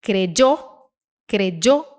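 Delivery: "Creyó" said kre-DJOH, hard j as in jeep. Shown as a demonstration. Teacher showing third option, "creyó" with dj sound for y. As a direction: put a dj burst in the y of "creyó". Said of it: "Ele pode ter o som de D J".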